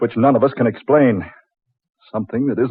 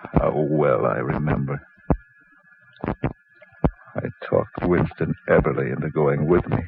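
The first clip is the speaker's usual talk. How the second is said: reflective narration. A narrator's reading. Inner monologue narration opening.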